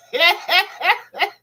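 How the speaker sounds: high burst of laughter. Real, not acted. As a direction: laugh out at the comment. Reacting to a viewer's comment.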